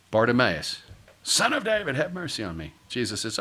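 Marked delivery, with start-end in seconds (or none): 1.2-2.7 high acted cry for mercy, falling away; pleading call. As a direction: lightly act a beggar calling out. Acting Bartimaeus crying out to Jesus.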